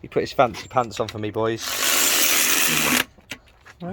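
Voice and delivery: ordinary delivery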